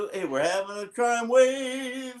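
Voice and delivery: high, drawn-out sing-out; hammed-up announcement. Host kicking off the Teenage Crime Wave episode, hammed-up announcement. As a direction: stretch it out high and showy.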